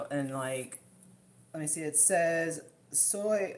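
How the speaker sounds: usual speaking voice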